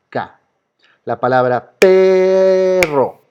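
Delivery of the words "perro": PEEE-rro, first syllable held long, high and loud; stress on first syllable. Shown as a demonstration. Teacher asks which syllable of perro is strongest, exaggerated stress on PE. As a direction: stretch the PE of "perro", then drop on "rro". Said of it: "la sílaba que suena más fuerte".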